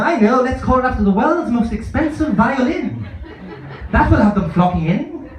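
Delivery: a hint of a smug director; mock board member.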